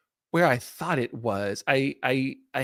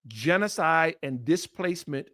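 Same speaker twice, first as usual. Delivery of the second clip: ordinary talk, nothing marked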